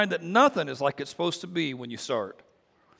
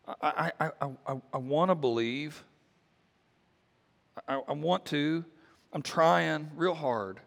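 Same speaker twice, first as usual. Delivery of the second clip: struggling plea; 4 dB quieter than usual. Retold or imitated. Father's struggling plea retold.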